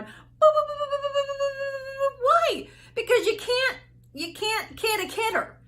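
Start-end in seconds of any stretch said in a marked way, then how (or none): 0.0-2.2 BS meter alarm. Retold or imitated. Coach mimics her BS meter beeping at clients' excuses. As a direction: imitate a squeaky detector going off. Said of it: "my B S meter, I'll be going".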